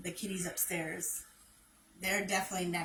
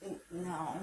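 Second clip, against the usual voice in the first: ordinary talk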